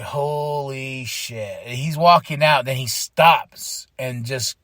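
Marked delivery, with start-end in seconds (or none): 0.0-1.6 slow, soft awed gasp of words; awed disbelief. Retold or imitated. Fan watching the crutches come off, slow awe. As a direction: let it out slowly in awe. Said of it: "this is where I was like".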